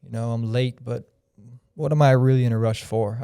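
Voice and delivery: ordinary talk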